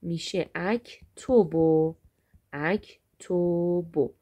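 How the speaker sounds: اکتبو stretched slowly, each vowel clear; slow careful pronunciation. Shown as a demonstration. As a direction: say اکتبو slowly so students hear the o. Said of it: "مثلاً کتبه".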